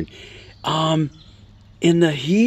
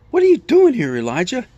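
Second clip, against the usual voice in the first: high, loud burst of a question; God's questioning voice; 10 semitones higher, 4 dB louder than usual. Retold or imitated. God speaking to Elijah in the cave, high loud questioning. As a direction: call out in pitched-up surprise, then drop on the name. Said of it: "this voice comes to him".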